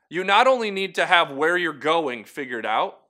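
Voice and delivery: ordinary delivery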